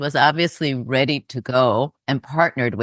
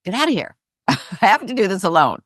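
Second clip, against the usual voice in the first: higher-pitched mock dismissal with a laugh; kicking everyone out; 6 semitones higher than usual. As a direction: playfully imitate an aunt shooing everyone away. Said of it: "kicked everyone out of the room".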